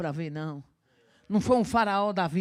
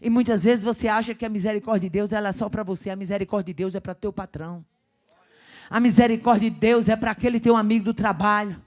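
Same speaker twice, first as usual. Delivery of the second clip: preaching building to a shout; 10 dB louder than usual. Acted. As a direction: build the congregation to the peak.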